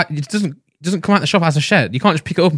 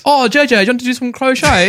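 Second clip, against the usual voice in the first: high, exaggerated posh voice; 8 semitones higher than usual. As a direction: play a snooty posh kid. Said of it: "That's my posh voice".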